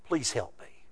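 soft, low imitated plea; quietly beg, lightly acted; humble plea. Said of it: "notice her attitude".

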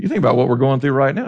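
usual speaking voice

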